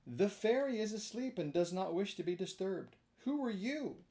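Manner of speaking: a hint of a sleepy old snail; mild snail voice. A narrator's reading. Snail voice speaking.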